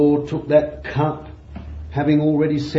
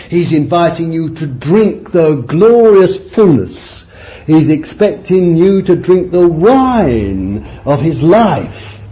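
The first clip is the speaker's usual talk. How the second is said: loud, driving preacher's call; fiery preaching; 4 semitones higher, 6 dB louder than usual. Real, not acted. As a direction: preach it out, rising with fervour. Preaching at full force.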